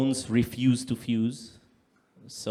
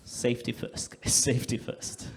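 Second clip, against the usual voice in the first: laughing whisper; 4 dB quieter than usual. Real, not acted. Laughing repeat of the sign.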